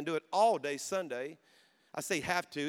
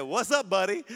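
bright, louder forced cheer; cheerful greeting; 3 semitones higher, 6 dB louder than usual. Retold or imitated. A father greets his arrested son through the police car window, with put-on cheer. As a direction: put on a big smile and greet him. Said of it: "Put the biggest smile you possibly can".

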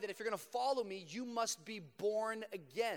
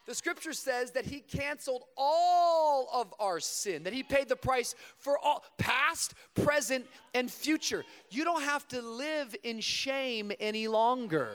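louder, slow proclaiming preacher; emphatic preaching; 4 semitones higher, 6 dB louder than usual. Acted. Preacher proclaims forgiveness to the crowd, louder and slow. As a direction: declare it to the congregation.

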